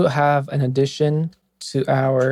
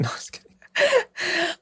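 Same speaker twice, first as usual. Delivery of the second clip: laughing; 5 dB quieter than usual. Real, not acted. Teasing after spilled water.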